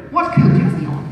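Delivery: mock-polite flirtatious suitor; a hint of an insinuating beau; foppish gallant.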